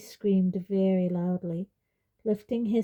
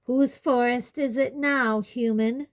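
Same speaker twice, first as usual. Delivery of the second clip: raised, challenging centaur voice; bellowed taunt; 4 semitones higher, 3 dB louder than usual. A narrator's reading. Bane taunting Umbridge, raised bellowing challenge. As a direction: throw it at them, pitched higher. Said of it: "bellowed Bane".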